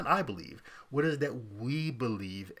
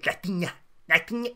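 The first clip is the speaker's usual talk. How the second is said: high, loud, exaggerated Spanish accent; mock Spanish accent; 6 semitones higher, 6 dB louder, faster than usual. Host mocking situational accents, showy Spanish pronunciation. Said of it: "I don't like situational accents".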